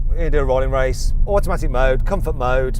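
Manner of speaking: ordinary delivery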